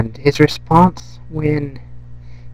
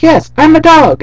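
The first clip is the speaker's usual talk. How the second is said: much higher, louder yell; yelling back; 8 dB louder than usual. Retold or imitated. Neighbor's dog yelling back at Brian. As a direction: holler back across the fence. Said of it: "the other dog yells back".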